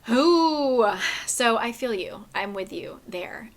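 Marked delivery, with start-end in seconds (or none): 0.1-0.9 high, loud cringing ooh. Retold or imitated. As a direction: react like it made you wince. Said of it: "and I was just like".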